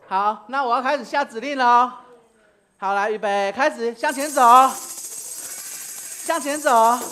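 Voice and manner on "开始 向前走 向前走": 大声喊口令; calling out commands. Real, not acted. Leading children in a game.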